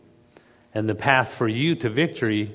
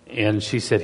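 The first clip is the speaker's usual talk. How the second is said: ordinary talk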